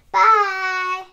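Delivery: plain reading voice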